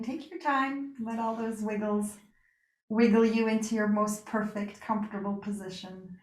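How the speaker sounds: ordinary delivery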